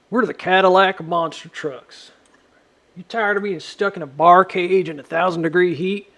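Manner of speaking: mock luxury ad pitch; mock commercial. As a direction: sell it like a cheesy TV spot.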